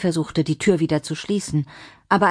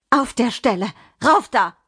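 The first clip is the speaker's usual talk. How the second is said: urgent fearful command; 5 semitones higher than usual. Acted. Frightened Mimi ordering Adriana upstairs, urgent.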